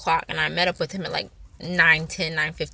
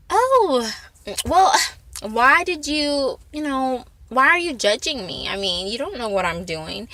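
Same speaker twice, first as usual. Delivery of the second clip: mock innocent surprise; 5 semitones higher than usual. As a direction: play dumb sweetly to calm a stalker. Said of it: "I didn't make it seem like he was crazy".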